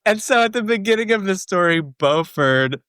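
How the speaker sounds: high, fast, still laughing. Real, not acted. Trying to keep telling the story, laughing. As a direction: push on through the giggles.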